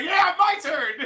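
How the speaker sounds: very high mock-eager cry; gleeful imitation. Latecomer jumping into a beatdown, gleeful imitation. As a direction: mimic a latecomer in a squeaky high voice.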